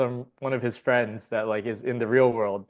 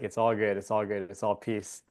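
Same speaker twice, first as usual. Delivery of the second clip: serene master; 3 semitones lower, 6 dB quieter than usual. Retold or imitated. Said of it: "the master's just like".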